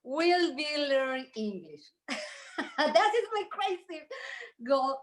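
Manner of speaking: giggling it out; laughing confession. Real, not acted. Agent laughs as she admits her crazy goal, laughing. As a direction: laugh through the words, half embarrassed.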